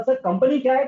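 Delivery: mock student asking sir; imitating a student. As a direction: play the puzzled student asking. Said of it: "और फिर बच्चे ने सवाल पूछा".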